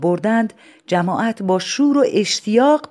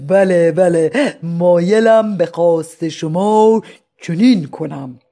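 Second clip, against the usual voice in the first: slow, loud dignified consent; slow stately madman; 5 dB louder, slower than usual. Acted. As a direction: answer like a statesman.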